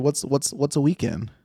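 ordinary talk

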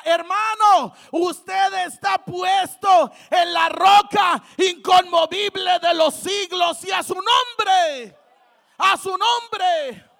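loud, slow, stretched proclamation; impassioned preaching shout. Real, not acted. Preacher assuring a brother he stands on the rock, impassioned shout. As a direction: thunder it out phrase by phrase. Preaching climax, call to congregation.

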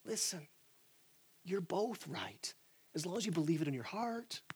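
a falsely calm peacemaker smoothing things over; soothing peacemaker impression. Someone steps into the parking lot argument, softly soothing. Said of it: "He goes".

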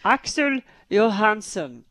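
slow, lightly Swedish name; Swedish accent. Pretend. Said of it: "You did the face of a Swedish accent".

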